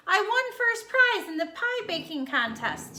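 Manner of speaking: exclaiming. Shown as a demonstration. Wayne telling everyone about the pie contest, excited. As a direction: announce the win with pride. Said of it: "Wayne exclaimed".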